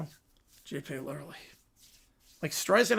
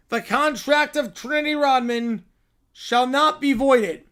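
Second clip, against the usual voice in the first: solemn decree; 5 semitones higher, 6 dB louder than usual. Acted. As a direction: proclaim it to the whole galaxy. Reads a grand galactic decree.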